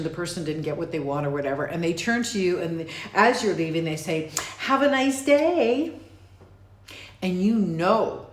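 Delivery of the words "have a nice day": sugary high, slow, louder sarcasm; fake-nice parting shot; passive-aggressive stranger wishing a nice day. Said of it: "not actually wishing you a nice day".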